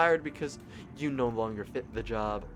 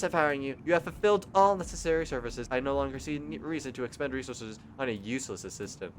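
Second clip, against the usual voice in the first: ordinary talk, nothing marked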